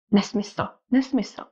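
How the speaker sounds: NESMYSL said twice, firmly, the second a little livelier; mildly emphatic NESMYSL, falling at the end; emphatic denial. A narrator's reading. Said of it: "to důrazné popření".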